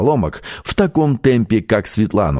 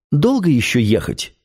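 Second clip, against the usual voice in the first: put-on slightly whiny, higher-pitched child; lightly voiced fretful girl; a capricious note; 4 semitones higher than usual. A narrator's reading. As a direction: ask with mild impatient petulance. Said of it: "с капризной ноткой воскликнула".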